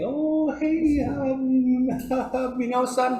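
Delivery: high-pitched elder voice. Retold or imitated. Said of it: "I was out with an elder".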